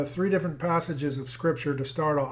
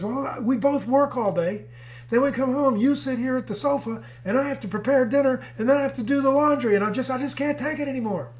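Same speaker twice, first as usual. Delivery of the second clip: high-pitched mock rant, piling up chores; exasperated wife; 8 semitones higher than usual. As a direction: play an overworked wife venting, hammed-up. Exasperated wife acted out in story.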